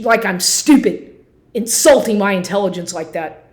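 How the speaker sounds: loud, outraged venting with hard-hit swearwords; real angry rant. Real, not acted. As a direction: rage at someone who dared come back. Heated rant at absent betrayer.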